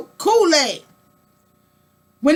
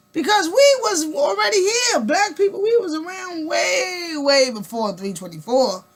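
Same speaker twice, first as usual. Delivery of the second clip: choked, crying shout of outrage; half-sob, half-yell the protest; tearful indignant yell; crying outburst; 4 semitones higher than usual. Acted.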